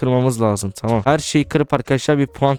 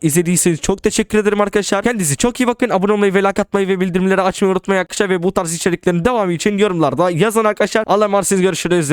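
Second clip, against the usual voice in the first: higher, fast cheerful sign-off; YouTuber outro; 7 semitones higher, faster than usual. Acted. Gamer thanking viewers and asking for subscribes, fast upbeat outro. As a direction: rattle off the outro brightly and quickly.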